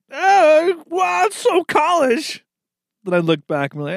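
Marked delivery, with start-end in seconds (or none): 0.1-2.4 high, slow gushing awe; gushing partygoer. Retold or imitated. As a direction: gush like an impressed party guest. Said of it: "I'd be like".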